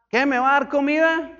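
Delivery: high, loud mock indignant question; mock surprise. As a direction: act out a startled passenger. Mock passenger surprised again.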